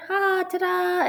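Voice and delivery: high, drawn-out ta-da; ta-da reveal. Real, not acted. Teacher showing students the calculator's result, a sing-song ta-da. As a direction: present it like a magic trick, high and slow.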